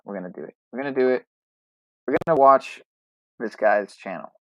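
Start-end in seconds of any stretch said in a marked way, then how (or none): 0.1-0.6 talking to himself as he makes up his mind; quiet aside. Real, not acted. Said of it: "You know what?".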